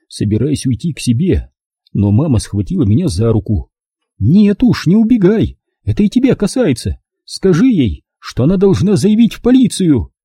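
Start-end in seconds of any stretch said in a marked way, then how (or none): 4.2-7.0 high-pitched, commanding mother; insistent mother. Acted. Mother stopping son from leaving the quarrel, insistent. 7.2-8.0 high, firm request from a mother; insistent order. Acted. Mother telling son to back her up, insistent. 8.2-10.1 high-pitched, commanding mother; insistent mother. Acted. Mother stopping son from leaving the quarrel, insistent.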